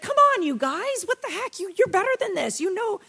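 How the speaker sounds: high-pitched exasperated pleading; exasperated Paul. Retold or imitated. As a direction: plead like a let-down teacher who expected better. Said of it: "Paul was just kinda exasperated".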